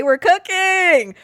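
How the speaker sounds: high, slow, loud hype shout; hyped. Real, not acted. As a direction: crow it like a victory. Hyped celebrating his own advice.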